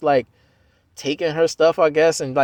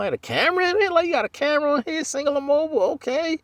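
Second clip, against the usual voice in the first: high mock-astonished voice; seeing a camera phone for the first time, high and amazed; 14 semitones higher than usual. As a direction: gush over the phone like a wide-eyed kid.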